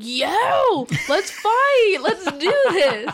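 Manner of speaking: laughing, loud, high challenge to fight; mock fight challenge. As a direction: egg them on, laughing. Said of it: "you're just talking calm and I'm like".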